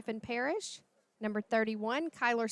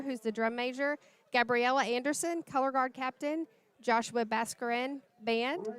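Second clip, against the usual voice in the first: ordinary delivery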